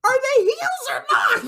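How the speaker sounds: high, loud mock outrage; hammed-up bewildered yelling; exasperated shout. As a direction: demand an answer, breaking into laughter. Host playing exasperated over wrestlers' roles.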